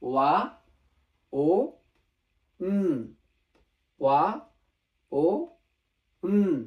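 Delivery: ওয়া, ও, উম as loud separate syllables; pronunciation drill. Shown as a demonstration. Teacher repeating the new letters for learners, pronunciation drill. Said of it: "That means".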